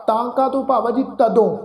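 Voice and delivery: ordinary talk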